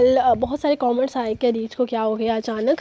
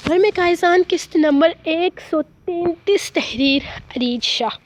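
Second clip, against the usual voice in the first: novel reading. A narrator's reading. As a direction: read the episode like an audiobook narrator, unhurried. Reads novel episode aloud.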